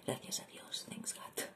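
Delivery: hushed, whispered aside; whispered thanks. Real, not acted. Thanking God for time while the kids sleep, whispered. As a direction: murmur it under your breath, very softly.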